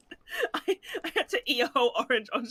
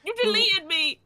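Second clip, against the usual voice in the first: mock outrage; 10 semitones higher, 7 dB louder than usual. Real, not acted. Friend cut from stream scene.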